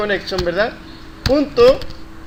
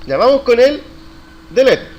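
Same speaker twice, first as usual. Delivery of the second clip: loud, raised teacher's call; rallying the class; 4 semitones higher, 6 dB louder than usual. Real, not acted. Teacher moves the class on to delete, loud rallying call. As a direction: announce the next step loudly, pitched up.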